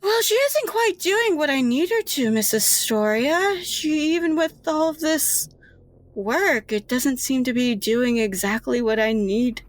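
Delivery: lightly voiced high nurse; nervous nurse. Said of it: "And she goes".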